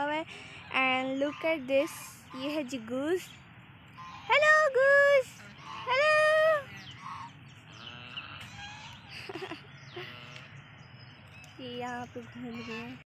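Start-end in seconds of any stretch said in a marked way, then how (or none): 4.3-6.2 a much higher, louder sing-song hello to a goose; bright squeaky hello called out to a bird; high goose greeting. Real, not acted. 6.2-11.6 a much higher delighted giggle; long high laugh trailing off. Real, not acted. Laughing after greeting the goose.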